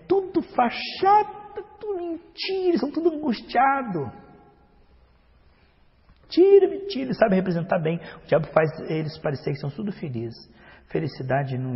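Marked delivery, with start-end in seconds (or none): 0.1-4.1 mocking complainer. Preacher mimicking a jealous believer, high whiny mock. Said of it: "Ah, mas os ímpios estão se divertindo". 6.3-7.0 loud, high retort; forceful rebuttal. Real, not acted. Preacher rebutting the complainer, loud and high.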